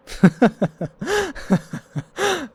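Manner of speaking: high-pitched burst of laughter; real high cackle. Real, not acted. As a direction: let out a squealing laugh.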